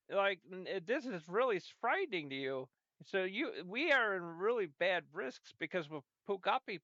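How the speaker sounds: usual speaking voice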